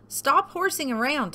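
high, lightly scolding cow; teasing scold. A narrator's reading. Cow to disguised Turkey, high scold.

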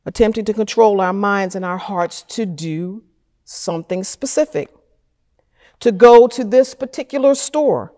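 ordinary talk, nothing marked

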